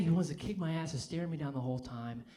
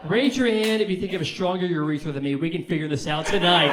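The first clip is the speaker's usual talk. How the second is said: much louder, high and hyped throughout; loud crowd work; 4 semitones higher, 11 dB louder than usual. Real, not acted. Comic wrapping up, yelling at the crowd about his urethra. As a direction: shout it to the whole room with rising energy.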